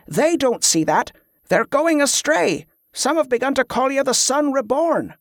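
high, insistent plea; almost desperate. A narrator's reading. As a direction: press urgently, pitch lifted. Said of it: "sounding almost desperate".

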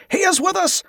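high-pitched rallying cry; voice from the crowd. A narrator's reading. Pilgrim rallying the crowd behind Tezcah, high cry. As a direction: call it high over the mob. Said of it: "came a voice from the crowd".

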